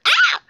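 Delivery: short, very high yelp; brief play scream of pain. Pretend. Hurt Frankie cries out.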